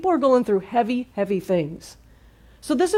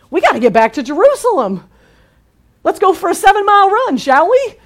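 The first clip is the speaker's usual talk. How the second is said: much higher and louder, eager to rush off; excited urgency; 9 semitones higher, 5 dB louder than usual. Retold or imitated. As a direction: play the disciples fired up to run back. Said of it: "They're like".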